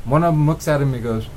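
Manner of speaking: ordinary talk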